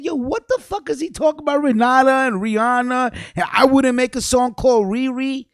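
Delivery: high, fast, incredulous retelling of his own reaction; baffled retelling. Retold or imitated. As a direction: replay your shock high and fast, half laughing at it. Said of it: "I said".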